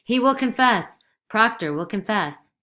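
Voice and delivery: loud, high call; calling down corridor. A narrator's reading. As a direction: call it down the hall. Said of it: "calling down the corridor".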